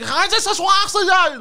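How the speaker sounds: shrill, slow, loud German yelling; aggressive German SpongeBob. Retold or imitated. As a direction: yell it like a furious cartoon. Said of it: "it was like".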